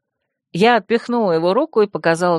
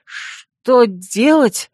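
very slow, stretched, higher question; terrified wheeze; 4 semitones higher, 4 dB louder, much slower than usual. A narrator's reading. Pale fox staring in horror at someone, hoarsely wheezing. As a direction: wheeze it out, drawing out every word. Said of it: "и просипел".

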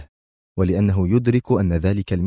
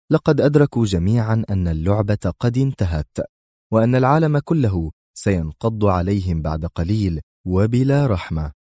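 usual speaking voice

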